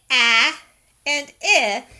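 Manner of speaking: "a" and "I" each punched loud on its own; loud, isolated "a", then "I"; separate vowel sounds. Shown as a demonstration. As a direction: say "a" and "I" as two short, separate letter sounds. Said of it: "They do not say".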